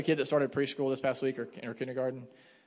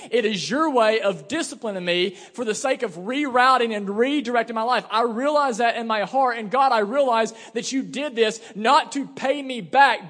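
loud, high, building preacher's cry to God; fervent preached confession; impassioned prayer; 6 semitones higher, 6 dB louder than usual. Acted. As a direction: pray it out at full force, pressing every phrase. Preacher voices Jonah's prayer at full force.

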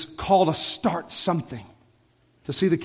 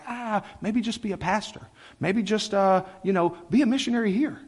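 higher, hedging voice of cautious advice; hesitant counselor; 5 semitones higher than usual. Retold or imitated. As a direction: mimic someone gently talking you down. Voices counsel to play it safe.

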